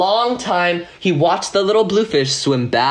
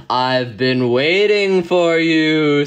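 slow, low octopus voice, heavy and drawn out; deep voice; 3 semitones lower, much slower than usual. Acted. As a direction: rumble it slowly like a giant sea creature. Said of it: "said the octopus in a deep, deep voice".

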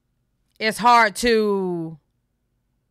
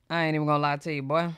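ordinary talk, nothing marked

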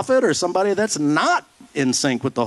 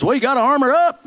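higher, louder glib church advice; mock preachy reply; 10 semitones higher, 4 dB louder, faster than usual. Pastor mocking glib advice to the depressed, higher and louder. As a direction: chirp it back like a pat answer. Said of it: "I'm depressed".

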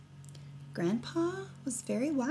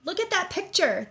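put-on demanding kids; 8 semitones higher than usual. A narrator's reading. Children demanding, pitch up.

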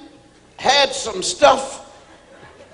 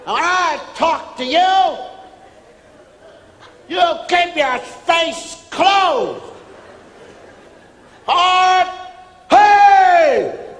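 drill instructor yelling; 8 semitones higher, slower than usual. Retold or imitated. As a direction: tear into the recruit word by word.

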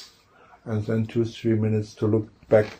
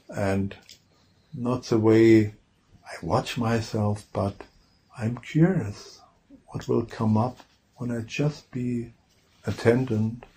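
trance introduction voice. Real, not acted. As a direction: ease listeners into trance, leaving space between phrases. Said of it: "change my voice into my trance introduction voice".

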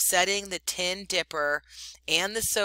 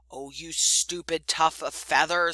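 angrily. Acted. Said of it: "said Rikki Tikki angrily".

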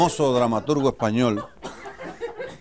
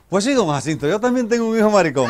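put-on higher, louder chatty old mother; old lady finally speaking; 9 semitones higher, 6 dB louder than usual. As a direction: blurt it out to the guest, voice rising. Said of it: "al fin se decidió y le dijo". (vieja decidida, voz aguda y fuerte)

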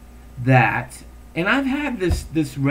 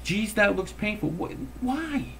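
exasperated thought; 8 semitones higher than usual. Retold or imitated. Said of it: "I thought".

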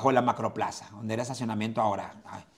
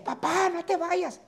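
put-on wailing child; slower than usual. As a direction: wail it like a small boy clinging to the hearse. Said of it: "Y luego otra vez".

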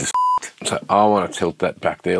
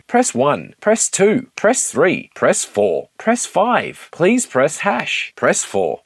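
higher, polished automated menu read; phone menu voice; 7 semitones higher, much slower than usual. Shown as a demonstration. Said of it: "doing voiceovers for a customer".

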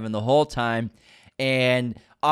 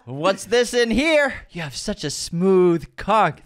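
slow, high tipsy cooing; mock seductive; 5 semitones higher, slower than usual.

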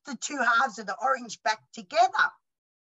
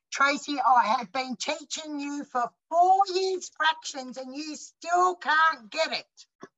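teacher's put-on voice; 6 semitones higher, slower than usual. Teacher scolding her in story.

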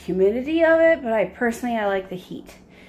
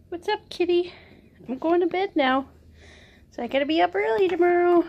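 pet talk; 5 semitones higher, faster than usual. Real, not acted. Owner playfully teasing her jealous pets, high baby talk. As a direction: tease the piggy and kitty in a high voice.

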